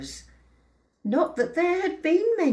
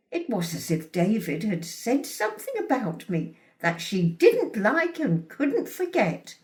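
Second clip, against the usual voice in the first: plain reading voice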